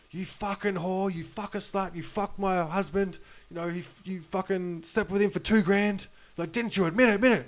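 high-pitched mock tirade; retold angry yelling. Wife accusing the waitress of sleeping with her husband, mock angry yelling. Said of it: "this lady's yelling at her".

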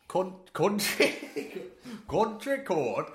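slow, giggling attempt at a Welsh "country code"; laughing through a Welsh accent. Host cracking up while attempting Welsh for co-host, laughing through the words. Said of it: "This is appalling".

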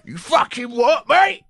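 slow, loud challenge rising high; mock aggression. Hard man challenging someone, mock outrage. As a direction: square up with a climbing question. Mock confrontation as Ray Winston.

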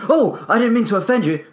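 high, loud, flustered apology. Retold or imitated. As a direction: blurt it out like a startled man. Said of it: "if he reacts like".

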